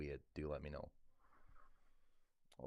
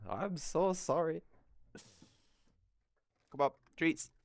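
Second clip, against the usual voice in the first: coaxing pet voice; 11 semitones higher, 7 dB louder than usual. Real, not acted. Owner luring cat with treats, high coaxing voice. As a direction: call the cat sweetly for treats.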